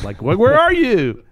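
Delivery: high, loud, slow jeering call; mock taunt. David mocking cheerers hiding behind rocks, slow loud taunt. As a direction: drag it out jeering at cowards.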